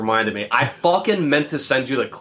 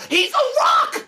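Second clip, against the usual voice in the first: Elmo snapping; slower than usual. Retold or imitated. Friend retelling Elmo yelling at Zoe, very high shout. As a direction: yell it like furious Elmo. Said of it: "He goes".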